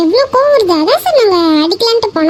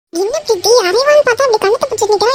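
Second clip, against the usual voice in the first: normal talking voice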